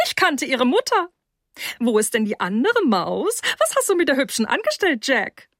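high, swooping, gossipy woman's voice; pry teasingly in a high, tipsy voice; high-pitched mother needling her son; tipsy, nosy mother. Acted.

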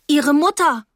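high, loud exclamation; agitated girl calling across; urgent call. Acted. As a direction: call out in alarm. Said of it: "rief ich".

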